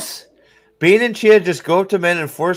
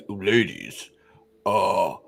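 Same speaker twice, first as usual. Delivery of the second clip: much lower, slow, nervous giant's voice; panicked young Ogier; 9 semitones lower, slower than usual. Retold or imitated. Loial panicking at the women, low and slow. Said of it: "he should be freaking out going".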